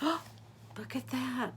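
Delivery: soft gasp of wonder. Acted. Gasp reading picture book aloud.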